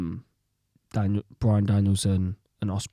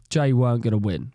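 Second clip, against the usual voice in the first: usual speaking voice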